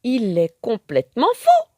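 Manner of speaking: loud, high irritated snap; annoyed outburst. A narrator's reading. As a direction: vent your annoyance. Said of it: "s'énerve Charlotte".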